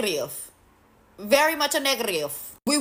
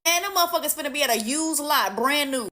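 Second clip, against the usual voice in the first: usual speaking voice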